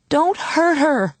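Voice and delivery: high, drawn-out cry; desperate shout. A narrator's reading. Woman shouting after Paula to protect the girl, desperate. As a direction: call after them in alarm. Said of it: "I shouted after Paula".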